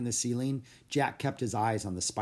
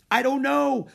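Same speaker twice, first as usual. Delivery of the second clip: high, loud panicked reply; scared answer; 8 dB louder than usual. A narrator's reading. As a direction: blurt it out in fear. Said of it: "said Jack".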